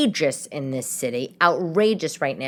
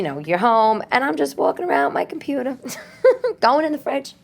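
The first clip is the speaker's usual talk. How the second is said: higher pitch, breaking into laughter; amused laughing; 6 semitones higher than usual. Real, not acted. Podcaster walking to the fridge mid-show, real laughing. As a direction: laugh your way through it.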